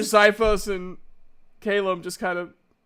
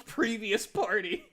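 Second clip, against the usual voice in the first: usual speaking voice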